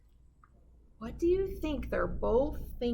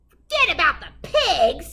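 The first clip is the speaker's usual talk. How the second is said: loud laughing disbelief; scoffing laugh; 7 semitones higher, 10 dB louder than usual. Acted. Witch scoffing at the wolf with a loud laugh. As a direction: laugh it back at him.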